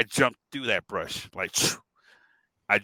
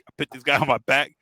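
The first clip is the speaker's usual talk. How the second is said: ordinary talk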